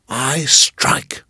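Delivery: whispered threat. Acted. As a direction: breathe the threat.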